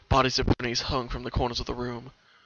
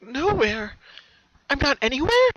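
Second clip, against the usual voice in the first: put-on much higher, louder girlish voice; baffled question; pony stunned by the blank map, confused; confused disbelief; 13 semitones higher, 7 dB louder than usual. A narrator's reading. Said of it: "she asked herself, confused".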